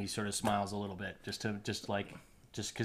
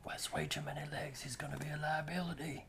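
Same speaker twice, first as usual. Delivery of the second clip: higher, softer gnome voice; a hushed little gnome, pitched up; Blumpkin; 6 semitones higher, 7 dB quieter than usual. Acted. Blumpkin quietly judging the stranger, high gnome voice.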